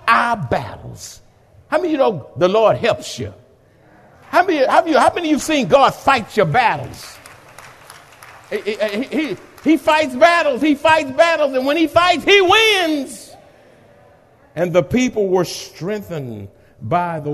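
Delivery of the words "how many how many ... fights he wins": higher, louder build; building to a shout; rising pulpit fervour; preaching at full force. Acted. Rising sermon climax.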